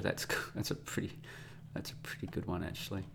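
soft whispered amusement; whispered chuckle. Real, not acted. Vlogger picking an episode title, whispered chuckle. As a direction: chuckle it under your breath.

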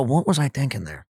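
understated embarrassed wonder; a hint of embarrassed self-talk. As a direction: mutter it like you're cringing. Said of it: "I go".

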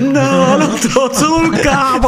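high, panicked mock cry; imitating a panicked cry. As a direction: squeal it out in mock despair. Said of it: "sul fuori onda ha fatto No!". (grido disperato imitato)

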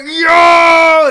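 huge, high-pitched shout of amazement; excited shout. Retold or imitated. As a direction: imitate freaking out over a lucky pull. Retelling own freak-out at a pull.

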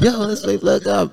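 put-on high, laughing party-girl voice; imitating the wife. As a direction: mimic her, high-pitched and giggly. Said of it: "that sound like her for real".

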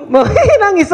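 laughing. Real, not acted. Joking about crying at wedding.

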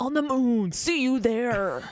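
slow laughing promo tag; monster truck ad. Pretend. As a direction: drag out the ad sign-off. Ad tagline, laughing.

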